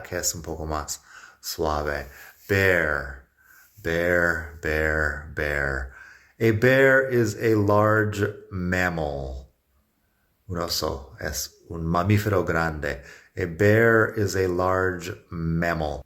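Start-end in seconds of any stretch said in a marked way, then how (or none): 2.5-5.9 "bear" with open e gliding into an American r; teacher drilling "bear" with a falling tune; model pronunciation. Shown as a demonstration. 6.4-9.5 slow, careful "A bear is a large mammal."; teacher reading the example slowly; slow model sentence. Shown as a demonstration. 13.4-16.0 "A bear is a large mammal." read slowly and clearly; teacher modelling the example; slow model sentence. Shown as a demonstration.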